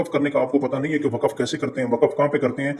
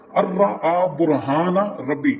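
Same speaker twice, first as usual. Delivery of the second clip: slow recitation; 3 semitones higher, slower than usual. Shown as a demonstration. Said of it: "बार बार मैं आपको बता रहा हूँ".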